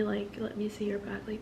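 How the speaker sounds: a scared whispered aside. Real, not acted. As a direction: hush it, no voice at all. Fearful about police seeing wound.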